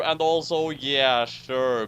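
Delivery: ordinary talk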